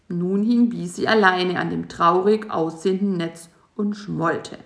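plain reading voice

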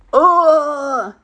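loud, high groan of revulsion; disgusted groan. Acted. Fly is offered aphid legs, disgusted groan. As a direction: groan as if about to retch.

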